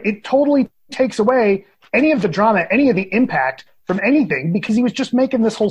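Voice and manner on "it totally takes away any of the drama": ordinary delivery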